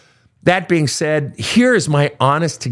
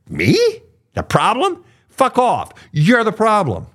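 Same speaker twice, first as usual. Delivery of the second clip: high-pitched mock indignation; indignant narcissist; 10 semitones higher, slower than usual. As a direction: act out offended, dismissive disbelief, pitched way up. Acting out narcissist refusing all blame.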